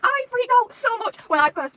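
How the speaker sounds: loud, high, drawn-out squeal; fangirl freak-out. Real, not acted. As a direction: squeal it slowly at full volume. Recalls freaking out over the book.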